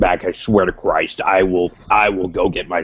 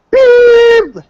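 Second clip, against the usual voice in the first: censor beep; 9 dB louder than usual. Pretend. Self-censoring a curse.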